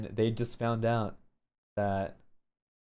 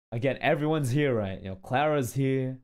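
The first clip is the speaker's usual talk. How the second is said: ordinary talk, nothing marked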